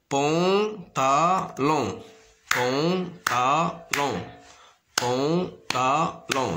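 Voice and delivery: "pantalon" very slow, in three separate beats; long syllables with gaps in "pantalon". Shown as a demonstration. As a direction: sound out "pantalon" as pan, ta, lon. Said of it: "se compose de trois syllabes".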